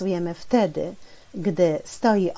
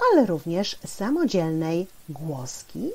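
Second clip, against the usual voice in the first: ordinary talk, nothing marked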